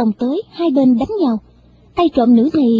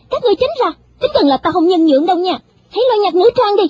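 cocky threat; 7 semitones higher, faster than usual. Acted. Thief threatens guards.

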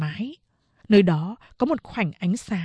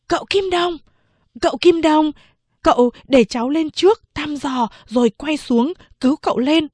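put-on high, louder boy calling from the roof; 6 semitones higher, 4 dB louder than usual. Servant boy on the roof beam calling down to his master. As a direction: call down through the hole. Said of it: "gọi với xuống".

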